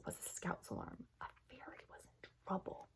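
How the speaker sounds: hushed dramatic whisper. A narrator's reading. Narrator whispering that a fairy is in trouble. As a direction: breathe it like a secret danger.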